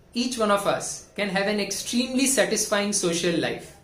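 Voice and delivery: ordinary talk, nothing marked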